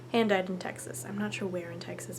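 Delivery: usual speaking voice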